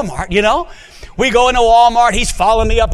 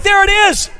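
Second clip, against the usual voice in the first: excited discovery; 12 semitones higher than usual. Retold or imitated. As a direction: shout it out like finding a treasure. Monica finds invitation, voiced.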